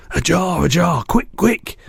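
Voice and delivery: high urgent calls. A narrator's reading. BFG urging Sophie for a jar, high and urgent. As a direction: hurry her, rising. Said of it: "he cried".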